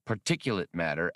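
usual speaking voice

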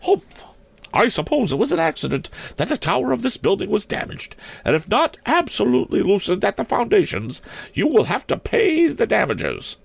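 very high, scolding schoolmistress voice; huff, then scold in a high matron voice; indignant matron. A narrator's reading. Principal tells airship men to pay for damage, indignant.

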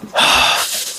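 long breathy acted sigh; dejected sigh. Boy asked what's wrong, imitated dejected sigh. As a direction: let out a heavy mock sigh. Retold friend sighing in dejection.

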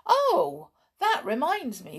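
high, loud burst of remembering; sudden recollection. A narrator's reading. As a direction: break in with an abrupt thought. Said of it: "said her husband suddenly".

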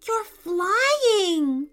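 high, loud amazed exclamation; laughed, delighted. A narrator's reading. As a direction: cry it out in delighted wonder. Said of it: "Jacob laughed".

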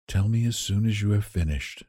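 quiet, tired invalid voice; sick Johnsy, quietly waiting for the last leaf; weary sick girl. Acted. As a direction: speak it softly, drained of strength. Johnsy's voice in the story.

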